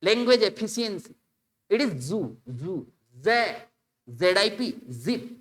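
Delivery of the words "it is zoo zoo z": zoo said with a buzzing z, not ज; correct English sound. Shown as a demonstration. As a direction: let the z in zoo buzz. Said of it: "इसको जू यदि आप पढ़ते हो तो गलत".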